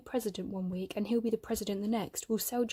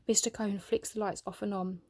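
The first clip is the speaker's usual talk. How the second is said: ordinary delivery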